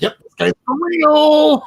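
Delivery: high and loud, mock amazed; impressed realization. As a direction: sound floored that he's legit. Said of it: "And I'm like".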